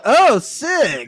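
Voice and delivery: high, loud "oh, sick"; mock-stoked exclamation. Mimicking him at the equation on the board, mock-stoked. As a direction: imitate a guy pleased with himself.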